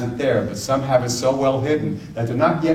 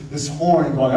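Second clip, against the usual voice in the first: usual speaking voice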